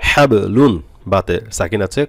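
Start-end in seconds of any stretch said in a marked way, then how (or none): 0.1-0.7 হাব লুন louder, the b echoed; qalqalah bounce. Shown as a demonstration. Teacher models ba with sukun, bounced হাব. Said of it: "কলকলা হবে".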